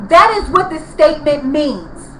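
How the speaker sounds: usual speaking voice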